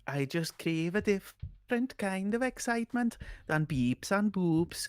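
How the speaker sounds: slow, lilting list with each item drawn out; stretched, sing-song character delivery; Ashley character voice. Acted. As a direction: play a game character craving excitement.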